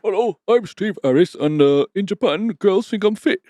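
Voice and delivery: hammed-up impression of a bassist introducing himself. To his co-host, a mock band member intro in a high voice.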